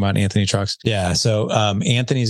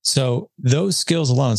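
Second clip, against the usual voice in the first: ordinary talk, nothing marked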